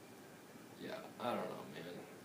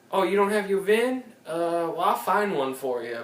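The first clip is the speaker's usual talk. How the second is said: sales rep; 7 semitones higher, 4 dB louder than usual. Retold or imitated. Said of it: "So they're like".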